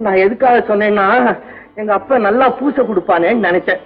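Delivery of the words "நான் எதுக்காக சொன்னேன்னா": ordinary talk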